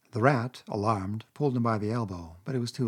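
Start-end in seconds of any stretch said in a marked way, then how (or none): none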